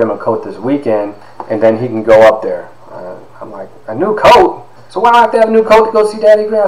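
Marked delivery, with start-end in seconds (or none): 3.9-6.7 put-on high, fast little-boy voice, indignant; a small boy pushing back hard at his mom; childish protest. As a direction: play his young self demanding why he needs a new coat. Child self protesting to mother.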